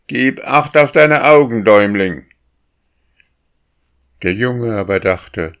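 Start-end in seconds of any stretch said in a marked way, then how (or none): 0.1-2.3 loud, high crow threat; threatening crow chief. A narrator's reading. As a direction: warn him harshly like a crow leader.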